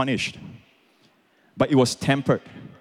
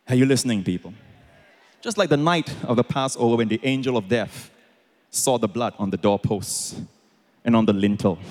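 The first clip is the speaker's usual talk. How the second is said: ordinary talk, nothing marked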